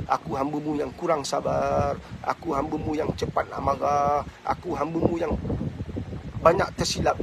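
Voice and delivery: low, soft, pleading zikir; hushed devotional prayer; quiet prayer. Acted. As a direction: murmur a humble supplication to God.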